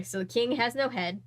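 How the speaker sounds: ordinary delivery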